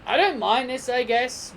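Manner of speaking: normal talking voice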